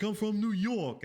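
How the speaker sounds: high, loud mock New York accent. Imitating the neighbor from New York, hammed-up New York accent. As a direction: exaggerate a New Yorker's vowels. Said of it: "you could hear it in her accent".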